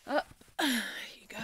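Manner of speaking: loud grunt of hoisting someone; lifting effort. Acted. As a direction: heave them up, slow and strained. Hoisting the royal onto shoulder.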